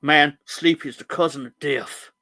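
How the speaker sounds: a hint of a slow, loud, grave narrator; lightly voiced movie narrator, slowed down and loud; mock Morgan Freeman voice. Host imitating Director John, slow and loud. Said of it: "I don't know why I said it like that".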